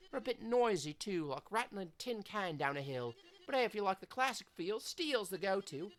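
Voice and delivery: a loud cheeky Aussie skater hyping a mate; Australian skater. Acted. Voices skater Zoe.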